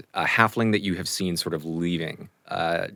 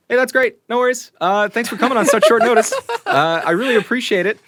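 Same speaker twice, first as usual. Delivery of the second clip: much higher, louder breezy hello; Chance's greeting; 9 dB louder than usual. Acted. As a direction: peek out and greet him brightly. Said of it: "and Chance goes".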